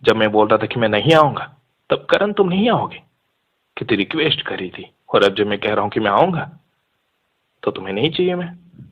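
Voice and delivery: ordinary delivery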